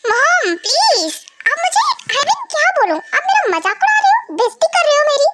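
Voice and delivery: high, whiny indignant protest; offended protest. Acted. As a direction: complain to mom that she is mocking you. Son protests mother mocking him.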